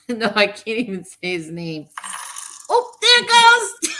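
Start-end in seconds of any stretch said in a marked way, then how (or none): 2.7-3.9 high, loud, drawn-out squeal; excited shout. Real, not acted. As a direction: shout it high with real delight. Donation sound goes off again.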